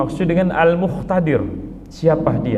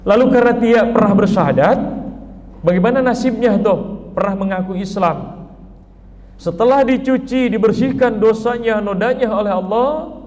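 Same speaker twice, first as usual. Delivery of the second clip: fervent preaching; 6 semitones higher than usual. Real, not acted. Preacher rises in fervour.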